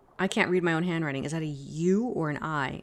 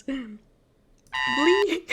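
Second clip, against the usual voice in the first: high drawn note; 8 semitones higher, 4 dB louder than usual. Retold or imitated. Imitating game tone.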